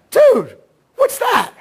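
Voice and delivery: high drawn-out astonished cry; surprised shout. Retold or imitated. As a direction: gasp it out as if you just found the culprit. Shocked discovery under the carpet.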